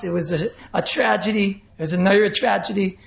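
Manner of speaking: ordinary delivery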